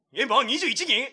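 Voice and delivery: surprised. Real, not acted. Surprised at viewer count.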